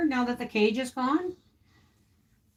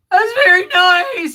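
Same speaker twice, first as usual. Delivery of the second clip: high, loud sarcastic cooing; overdone mock admiration; mock fake praise; 9 semitones higher, 8 dB louder than usual. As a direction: fake enthusiasm to tease him. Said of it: "And I'm like".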